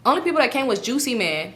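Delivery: ordinary delivery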